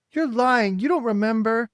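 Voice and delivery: put-on high, loud accusing children; accusing cry. A narrator's reading. Children accusing the girl of lying, loud and high. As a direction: fling the accusation at her. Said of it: "cried the children".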